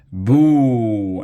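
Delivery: loud, high, drawn-out boo; long jeering call. A narrator's reading. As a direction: boo like a whole crowd. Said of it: "Everybody started booing".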